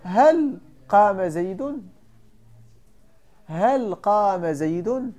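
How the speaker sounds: each word of هل قام زيد said slowly and distinctly; slow example sentence. Shown as a demonstration. Teacher to students, giving the example slowly. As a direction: spell the example out slowly for the class. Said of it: "تقول".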